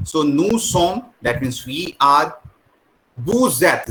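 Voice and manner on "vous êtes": "Vous êtes" with a Z joining the words; linking Z sound. Shown as a demonstration. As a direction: say "Vous êtes" as voo-zet. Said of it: "Z क्यों बोल रहे हैं". ("Vous êtes" में S को Z बोलें, "वू ज़ेत")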